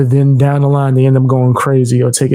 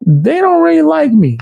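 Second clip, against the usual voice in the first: pitched way up, mocking; high mock voice. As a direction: squeak it up high. Mocking high voice about viewers.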